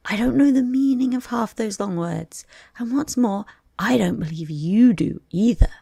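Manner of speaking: high, loud bird voice; a bold little bird talking back; cheeky young eaglet. Acted. The eaglet scoffs at the dodo in a high loud voice.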